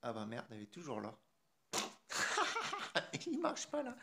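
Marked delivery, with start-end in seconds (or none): 1.7-3.2 high-pitched burst of laughter; real high giggling fit. Real, not acted. Laughs at his failed demo. 3.3-3.9 high, laughing words; squeezed out high through real laughter. Real, not acted. Cracks up as demo fails.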